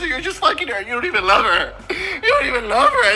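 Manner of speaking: high, wailing comic accusation; mock tearful outburst. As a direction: whine it as if bursting into tears. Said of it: "then I used my tears".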